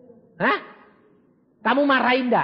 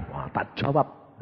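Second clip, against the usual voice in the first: ordinary delivery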